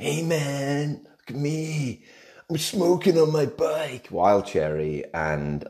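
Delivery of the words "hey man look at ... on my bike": high, laid-back mock biker dude; hammed-up leather jacket biker; cool biker. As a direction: play a cool guy showing off his bike. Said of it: "Can't do the accent".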